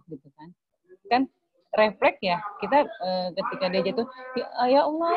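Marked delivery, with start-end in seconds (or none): 4.4-5.1 sympathetic exclamation. Retold or imitated. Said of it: "mengungkapkan rasa kasihannya".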